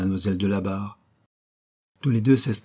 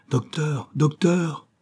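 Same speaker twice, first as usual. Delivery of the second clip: higher calling out for the doctor; wife calling the doctor; calling for help; 6 semitones higher, much slower than usual. Acted. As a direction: call up the stairs for help. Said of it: "se mit à appeler".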